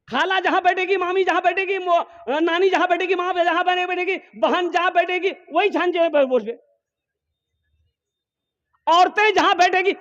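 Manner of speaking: high, sing-song mocking chant; mock the lazy youths in a high, teasing voice. Preacher mocking youths who sit among women, high and teasing.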